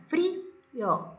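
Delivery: "frío" split into frí, o; syllable by syllable. Shown as a demonstration. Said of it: "Si la veamos". ("frío" silabeado: frí, o)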